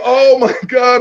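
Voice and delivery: high, loud stunned outcry; mock shock. Rapper reacting to his rival walking off, hammed-up shock. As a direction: act out amazed disbelief.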